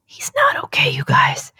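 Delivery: hushed conspiratorial whisper. Acted. Telling listeners Dan is stressed, whispered secretly.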